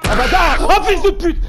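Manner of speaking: startled screaming curse; hit hard crying out. Real, not acted. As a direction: shriek out in sudden fright. Jumpscare in horror game.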